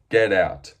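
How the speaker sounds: booming get out; loud dismissal. Real, not acted. Dismissing a fighter now five and three.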